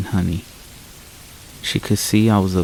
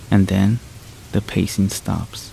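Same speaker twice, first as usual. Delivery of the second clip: ordinary delivery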